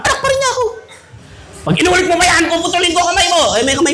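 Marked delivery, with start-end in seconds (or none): 0.0-0.6 high mock child voice. 1.6-3.5 higher, mock-stern mother's threat; a hint of a scolding mum; threatening mother.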